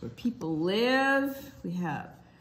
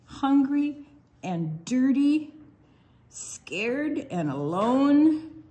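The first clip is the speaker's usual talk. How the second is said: slow, louder storybook refrain; read-aloud refrain; 3 semitones higher, 7 dB louder, much slower than usual. Acted. Librarian reading the kitten refrain to kids, slow and loud. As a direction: draw out each phrase for the little ones.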